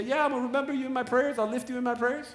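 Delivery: high, fast breezy voice; glib promise. Retold or imitated. Mocking people who promise prayers and forget. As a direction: toss off an empty promise. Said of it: "when people say".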